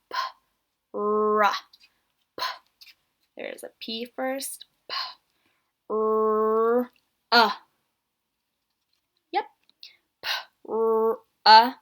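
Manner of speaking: single sounds pulled apart; sounding out letters. Shown as a demonstration. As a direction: isolate each sound for kids. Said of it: "what do you hear first when you stretch it out".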